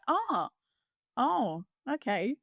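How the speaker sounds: high surprised "Oh, oh, okay"; imitating agents caught off guard; mock surprised oh. Agents realising the daughter is buying, mock surprise. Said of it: "And then they're like".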